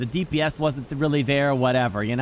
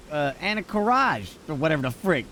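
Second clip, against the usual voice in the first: usual speaking voice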